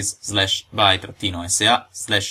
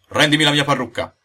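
loud, raised-pitch demand; indignant raised voice; cried out; 4 semitones higher, 6 dB louder than usual. A narrator's reading. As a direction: shout for it back. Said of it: "gridò Mastro Antonio".

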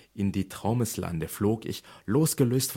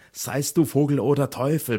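higher and louder, almost a cry; 7 semitones higher, 7 dB louder than usual. Acted. The narrator calls out at the raven, higher and louder. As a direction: fling the words at the bird.

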